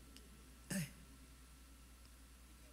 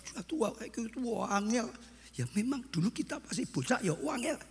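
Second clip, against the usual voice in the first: mock grumbling; 6 semitones higher than usual. Mimics grown child grumbling about parent.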